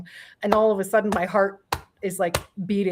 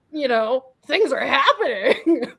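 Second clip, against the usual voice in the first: mock moaning; 6 semitones higher, 4 dB louder, slower than usual. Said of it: "And I'm moaning like".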